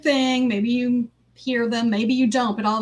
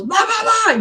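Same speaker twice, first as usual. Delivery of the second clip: much higher, louder mock yell; boss yelling; 11 semitones higher, 4 dB louder than usual. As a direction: bark gibberish like an angry boss. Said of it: "and yells something".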